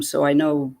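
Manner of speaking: plain reading voice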